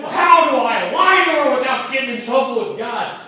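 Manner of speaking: high-pitched comic panic; mock desperation. Husband fearing his wife's question, mock desperate. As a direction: think aloud like a cornered husband. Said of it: "fighting for his life at the moment".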